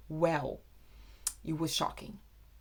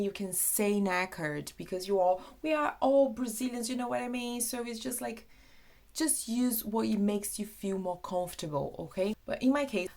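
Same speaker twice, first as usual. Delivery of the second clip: normal talking voice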